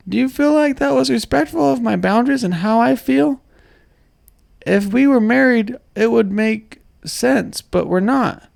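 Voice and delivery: high squeaky cartoon girlfriend voice; cartoon character voice. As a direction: read her texts like a cartoon. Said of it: "like a cartoon character, not like a woman".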